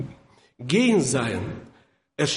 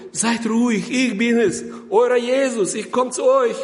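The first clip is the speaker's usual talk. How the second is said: reassuring call; 5 semitones higher than usual. Retold or imitated. Jesus calling to the frightened disciples.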